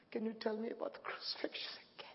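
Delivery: hushed, unvoiced child's plea; whispered plea. Boy whispering to mom after lights out, hushed. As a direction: whisper it like a scared boy at bedtime.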